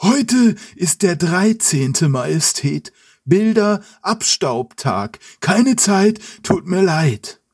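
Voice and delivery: high, slow servant voice; slow old servant. Acted. Servant Sanglier slowly refuses the king. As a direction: drawl out the excuse as the old servant. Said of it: "antwortet langsam".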